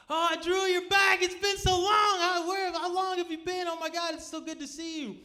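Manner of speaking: high, loud squealing welcome, easing off at the end; hammed-up ecstatic greeting; overjoyed reunion. Tripping friends see him come back, overjoyed.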